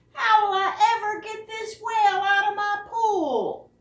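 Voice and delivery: lively picture-book read-aloud with voiced characters; reading aloud to children. A narrator's reading. Librarian reading a whale story to children, animated read-aloud. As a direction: bring the story alive for little listeners.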